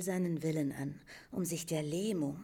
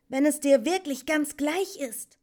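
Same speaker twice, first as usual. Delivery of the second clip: put-on high, loud, insistent young voice; urgent pressing; 11 semitones higher, 10 dB louder than usual. A narrator's reading. Atreyu pushes the indifferent Morla to talk, urgently. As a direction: press hard for an answer. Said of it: "drang Atreyu in sie".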